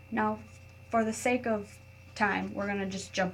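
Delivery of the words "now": ordinary delivery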